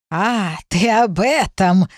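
slow, drawn-out crone; a witch amused and unhurried; old witch voice. Acted. Old witch answers demon.

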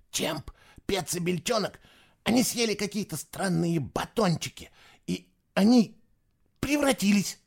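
put-on high, hushed boy's voice; frightened boy. A narrator's reading. Fisher telling Eva his friends transformed, anxious. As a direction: explain in a high, halting voice.

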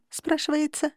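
plain reading voice

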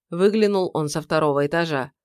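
ordinary delivery

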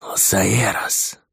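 drawn-out slow menacing hiss; sinister hiss. Acted. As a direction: hiss the name ominously. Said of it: "зловеще прошипел ещё один голос".

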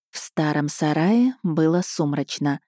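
ordinary delivery, nothing marked

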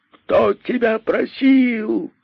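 высоко, медленно, негодующе; толпа возмущённо кричит; возмущённый крик. Acted. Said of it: "кричала возмущённая толпа". (возмущённая толпа кричит на находчивого, высоко и медленно)

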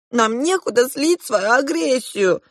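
high, slow complaint, mildly tearful; tearful confession. A narrator's reading. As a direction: wail it lightly, as a twin confessing. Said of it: "В слезах открылись мне сиамские близнецы".

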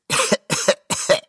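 acted high wheezy coughing fit; staged repeated coughing; old man's cough. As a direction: cough like a doddering old man.

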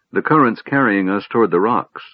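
ordinary delivery, nothing marked

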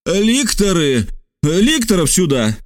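high, drawn-out call; exclaiming. A narrator's reading. Stranger summons the lictors, high urgent call. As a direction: call out for the guards. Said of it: "и воскликнул".

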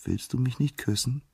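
soft, high, coaxing plea; enamoured nymph's tender question; lovestruck, gentle. A narrator's reading. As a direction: ask sweetly, smitten. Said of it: "ganz verliebt mit sanfter Stimme".

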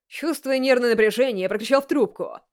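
ordinary delivery

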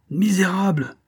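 high-pitched cry of outrage; outraged yell. A narrator's reading. Furious man to the impostor who disarmed him, high outraged yell. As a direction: yell it as an insult, pitched well up. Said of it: "hurla l'ami de Boisselin".

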